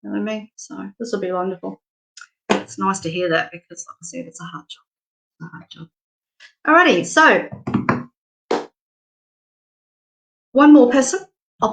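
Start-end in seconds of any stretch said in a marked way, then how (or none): 3.5-5.9 hushed aside. Real, not acted. Confiding aside about nursing.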